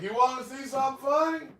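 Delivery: put-on high, quick teasing old lady voice; creepy old lady. Crazy old lady teasing the wary boy, high and quick. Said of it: "she looked at me like".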